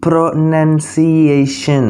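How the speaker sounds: "pronunciation" drawn out syllable by syllable; demonstrated pronunciation. Shown as a demonstration. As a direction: say "pronunciation" slowly, every syllable separate. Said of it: "pronounce ചെയ്യുന്നില്ലേ?". ("pronunciation" പതുക്കെ, അക്ഷരം തിരിച്ച് ഉച്ചരിച്ച്)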